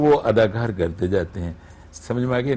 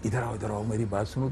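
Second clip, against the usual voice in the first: softer, close-mic calling; 7 dB quieter, faster than usual. Shown as a demonstration. Radio actor showing close-mic delivery, soft. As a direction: speak it close to the mic, intimate. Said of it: "जब मुझे करीब कहना होगा".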